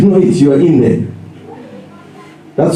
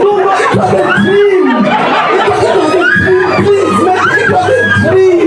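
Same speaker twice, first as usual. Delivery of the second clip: hammed-up protest, pitched way up to calm an angry wife; frantic backtracking; 11 semitones higher than usual. Acted frantic backtracking to his wife.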